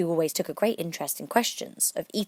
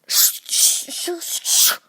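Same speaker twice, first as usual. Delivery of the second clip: hissed shh, shh; shushing; 13 semitones higher, 8 dB louder, much slower than usual. A narrator's reading. Hatter and March Hare shushing Alice. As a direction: hush her urgently. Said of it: "the Hatter and the March Hare went".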